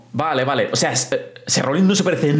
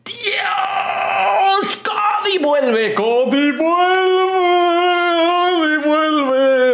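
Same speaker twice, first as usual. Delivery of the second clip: ecstatic drawn-out cry; 12 semitones higher, much slower than usual. Real, not acted. Cody returns, ecstatic.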